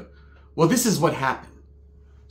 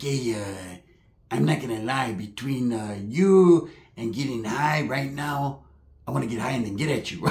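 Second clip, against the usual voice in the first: imitated low mumbly flirt; flirting dim cousin; 6 semitones lower, 6 dB quieter than usual. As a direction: hem and haw through a hushed come-on. Plays cousin's stoned come-on.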